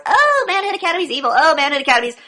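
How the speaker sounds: high-pitched mocking wail; mock butthurt. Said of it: "the fucking butthurt started".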